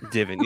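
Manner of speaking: "Divin" said as divin, not diving; mispronounced word. Shown as a demonstration. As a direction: blurt out "Divin" like the slip. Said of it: "You almost said divin".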